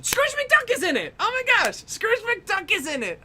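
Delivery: high, loud burst of excitement; thrilled fan squealing the news; excited shout. Real, not acted. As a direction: shout it like you just remembered. Excited realization Scrooge McDuck appears.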